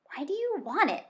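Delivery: suspicious fox, pitch jumping up; scowling fox. A narrator's reading. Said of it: "the fox scowled".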